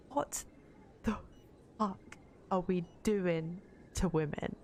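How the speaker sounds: WHAT, THE, FUCK each set apart by a pause; appalled emphasis. Real, not acted. Streamer appalled at how women are treated, word-by-word emphasis. Appalled at a story she read.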